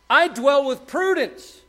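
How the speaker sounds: slow, high, loud proclamation; shouting out. Acted. Wisdom declaring herself to the street, shouting slowly. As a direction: cry it out to the whole street. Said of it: "I'm shouting out".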